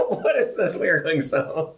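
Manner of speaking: high-pitched, laughing through the words; squeaky with real laughter. Real, not acted. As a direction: crack up while talking. Cracking up at accent attempts.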